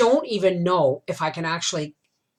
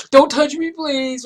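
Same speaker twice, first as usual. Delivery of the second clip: tearful plea; 9 semitones higher, 6 dB louder than usual. Retold or imitated. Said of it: "So I was a mess".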